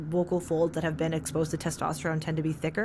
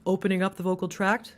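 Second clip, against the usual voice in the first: darker resonance. Shown as a demonstration. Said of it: "make it darker".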